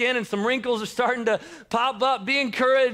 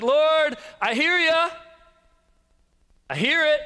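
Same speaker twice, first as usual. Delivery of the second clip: pleading doubt; 9 semitones higher, 3 dB louder than usual. Acted. Churchgoer telling the Lord, pleading doubtfully. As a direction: call up to the Lord, pitch lifted. Voicing a doubting listener to God.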